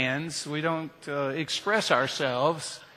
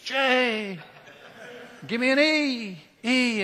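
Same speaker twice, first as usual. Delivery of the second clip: mock Jesus cheer; 7 semitones higher, slower than usual. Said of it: "did a Jesus cheer".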